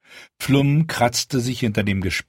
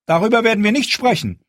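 raised, louder, faster angry queen; 13 semitones higher, 5 dB louder, faster than usual. A narrator's reading. Queen shutting down talk of the dragons, angry and raised. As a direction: end the discussion with fury. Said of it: "die Wut, die in ihrer Stimme mitschwang".

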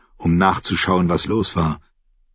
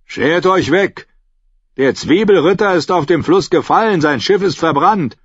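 higher, louder, dismissive guard voice; gruff guard; 9 semitones higher, 4 dB louder than usual. Acted. Guard dismisses the knight at the gate. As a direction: brush off the drunk. Guard sends him away.

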